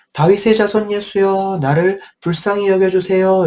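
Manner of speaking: high pleading call; crying out. Acted. Beggar calls to Jesus through the crowd, high pleading cry. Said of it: "이렇게 소리 지르니까".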